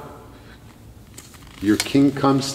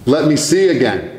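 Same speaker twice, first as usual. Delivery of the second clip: pleading cry; 6 dB louder than usual. Retold or imitated. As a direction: cry it out as an urgent plea. Plea repeated, crying out.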